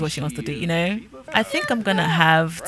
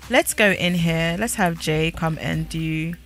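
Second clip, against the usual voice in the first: normal talking voice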